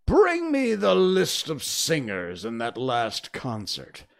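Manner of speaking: slow, loud royal order; loud, deliberate command; commanding queen. A narrator's reading. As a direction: draw out the demand like an imperious queen.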